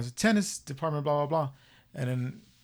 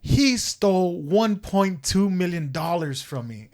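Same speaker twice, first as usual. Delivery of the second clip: slow, loud, raised-pitch accusation; retold yelling; 4 semitones higher, 6 dB louder, slower than usual. Retelling old man accusing man on the phone, yelling. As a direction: stretch out a mock yell, word by word. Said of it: "he yells at this guy".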